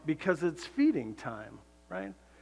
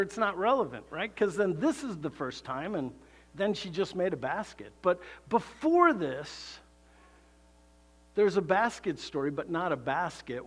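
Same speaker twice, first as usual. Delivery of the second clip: ordinary delivery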